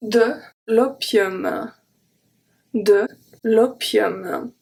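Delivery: de l'opium, very slow, with an open O in um; teacher drilling l'opium; slow careful pronunciation. Shown as a demonstration. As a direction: stretch out de l'opium slowly. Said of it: "make the sound um".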